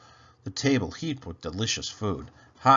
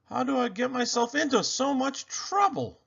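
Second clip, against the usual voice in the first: groaning despair; 14 semitones higher than usual. A narrator's reading. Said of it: "groaned Tingle".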